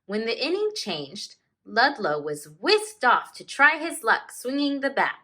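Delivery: slow, expressive read-aloud with long page-turn pauses; story narration. A narrator's reading. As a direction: read it like a teacher sharing a picture book with the class. Teacher reads picture book aloud.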